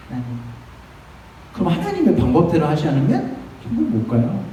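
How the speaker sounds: ordinary delivery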